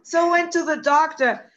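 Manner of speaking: ordinary talk, nothing marked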